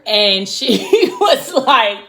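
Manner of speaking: laughing mid-sentence, words stretched out; real laugh interrupting the retelling. Real, not acted. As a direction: break into a laugh while telling it. Laughs while retelling the scene.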